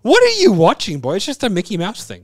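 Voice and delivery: high, loud, baffled dad; mock alarm at his son; incredulous parent. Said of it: "And I was like".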